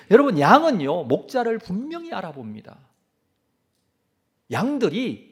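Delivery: loud, high, urgent sermon voice; fervent pulpit cry; preaching at full force. Acted. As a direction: preach it out to the whole congregation.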